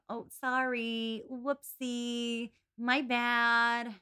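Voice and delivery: mock sugary apology, lightly done; sweet fake apology; fake sorry. Podcast host mimicking Lucy Steele's fake apology, mock sugary. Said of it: "got this veneer of".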